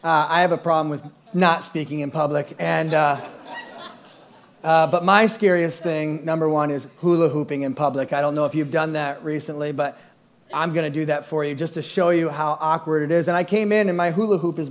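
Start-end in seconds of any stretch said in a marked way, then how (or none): none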